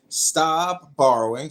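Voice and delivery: slow call for repeating; slow mantra. Real, not acted. Candidate has Pepe repeat after him, slow mantra. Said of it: "repeat it after me".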